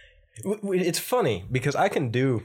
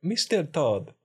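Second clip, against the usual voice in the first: higher stagey Italian accent; 4 semitones higher, slower than usual. Shown as a demonstration. Actor warming up with the character, Italian accent. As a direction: call it in a put-on Italian accent. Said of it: "Do, do his Italian thing first".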